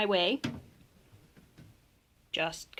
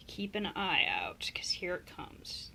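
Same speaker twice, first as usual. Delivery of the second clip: slow hushed whisper; 3 semitones lower, 6 dB quieter, slower than usual. Real, not acted. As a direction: hush it as the strike comes. Waiting for the strike.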